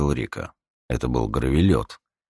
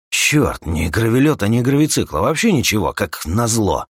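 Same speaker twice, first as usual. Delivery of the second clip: agitated prospector, higher and louder; взволнованный старатель; 7 semitones higher, 4 dB louder than usual. Acted. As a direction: think aloud with urgent frustration. (встревоженный старатель, повыше и погромче)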